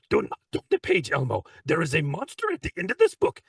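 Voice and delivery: high, pleading muppet voice; reading to kids as Grover. Acted. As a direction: warn Elmo in a high muppet voice. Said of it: "character to my kids".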